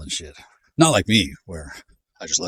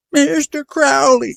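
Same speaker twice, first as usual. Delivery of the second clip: "Mister Crowley" drawn out, much higher, slow and mocking; mocking Brits; 9 dB louder, much slower than usual. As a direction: lampoon a snooty British caller. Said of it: "They'll say something like".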